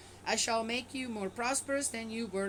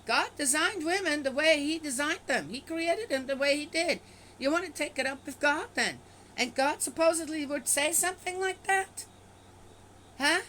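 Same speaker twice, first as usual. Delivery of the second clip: loud, higher indignant protest; outraged; 6 semitones higher, 5 dB louder than usual. Real, not acted. Objecting to the scripture about women, loud indignant outrage. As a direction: hurl it back at the verse, fuming.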